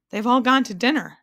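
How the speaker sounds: ordinary delivery, nothing marked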